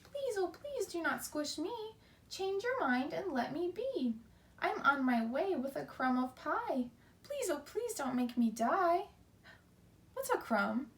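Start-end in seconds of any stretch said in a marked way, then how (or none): 0.0-9.0 high, pleading little ant voice. Acted. Teacher reading to class as the tiny ant, pleading. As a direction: beg for your life in a tiny high voice.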